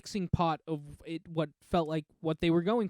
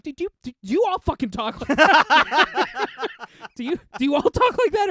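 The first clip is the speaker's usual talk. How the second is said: high, loud, incredulous yell; hammed-up outburst at Grogu; mock exasperated Luke; 10 semitones higher, 9 dB louder than usual. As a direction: play Luke losing it at the riddles. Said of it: "break down and be like".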